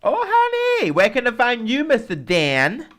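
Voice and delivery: campy diva. Acted. Co-host camps it up handing over to Dan, loud and high. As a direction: ham it up like a diva.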